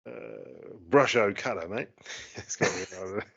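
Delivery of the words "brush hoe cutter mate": mock Australian accent. Answering what it's called in Australian, mock Australian accent. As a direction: say it like a hammed-up Australian. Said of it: "for the accent there".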